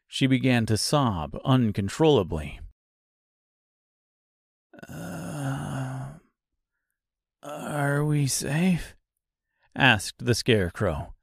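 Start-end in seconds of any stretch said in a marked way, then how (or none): 4.9-8.9 slow, faint and groggy; just waking up, drained; tired, groggy voice. Acted. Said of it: "in a tired, groggy voice".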